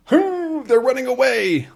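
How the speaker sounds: hammed-up gleeful shout; gleeful squeal. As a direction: squeal it in delight. Gleeful cry.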